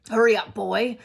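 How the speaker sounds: loud bellowed call; Uncle Vernon shouting. Acted. Uncle shouting from the kitchen at the boy, loud. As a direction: bark it from another room. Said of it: "shouted Uncle Vernon from the kitchen".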